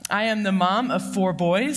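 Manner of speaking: usual speaking voice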